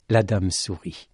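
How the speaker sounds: ordinary delivery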